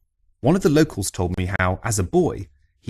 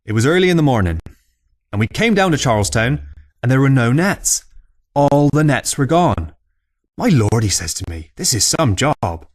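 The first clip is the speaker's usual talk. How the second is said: put-on louder, higher old fisherman spinning a yarn; old Cornish fisherman; 5 semitones higher, 5 dB louder than usual. Old man telling visitors a tale over a pint, loud rustic yarn-spinner. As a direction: bellow it like an old salt telling a tall tale in the pub.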